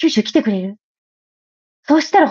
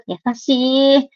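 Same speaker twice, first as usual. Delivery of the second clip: touched; much slower than usual. Real, not acted. Touched by a kind comment.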